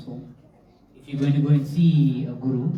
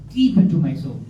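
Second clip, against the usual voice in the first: ordinary delivery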